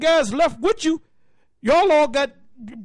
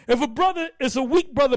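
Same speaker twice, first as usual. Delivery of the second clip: ordinary delivery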